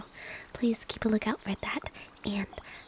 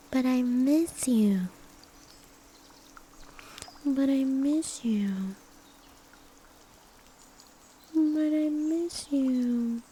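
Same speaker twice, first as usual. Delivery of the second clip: slow, pleading mock whimper; drawn out, pretend-heartbroken plea; slow, hammed-up pining; mock sad; 3 semitones higher, slower than usual. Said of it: "You're gonna make me cry".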